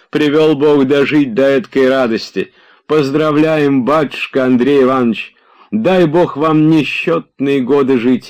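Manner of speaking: put-on an old servant blessing his master, overjoyed; old servant's joy.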